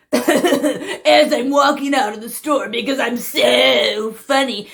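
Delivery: slow, giggly prankster voice; self-amused prankster. Acted. Prankster walking out of the store, giggly and pleased. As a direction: stretch it out, lightly self-congratulating.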